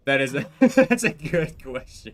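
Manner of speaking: awkward laughing through words; slow, laughing it off; nervous laugh. Real, not acted. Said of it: "stop doing that little awkward laugh".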